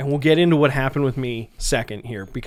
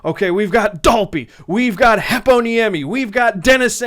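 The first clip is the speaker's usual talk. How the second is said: louder, higher pump-up call of the starting names; hype lineup reader; 7 semitones higher, 6 dB louder than usual. Retold or imitated. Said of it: "just very".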